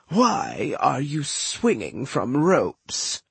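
slow, pointed demand. A narrator's reading. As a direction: make her demand an answer. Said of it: "she demanded".

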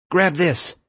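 high-pitched, urgent order; urgent command. A narrator's reading. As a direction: call it down to someone in danger. Urgent command during rescue.

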